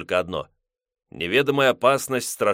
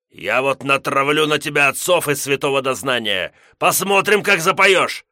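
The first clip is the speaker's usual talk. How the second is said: angry threat; 5 semitones higher, 6 dB louder than usual. Acted. Gos threatening.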